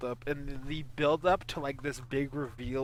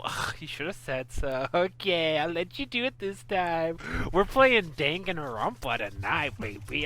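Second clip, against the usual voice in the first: usual speaking voice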